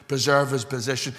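ordinary talk

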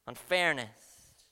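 normal talking voice

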